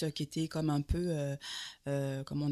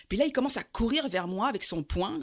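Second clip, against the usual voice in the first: ordinary talk, nothing marked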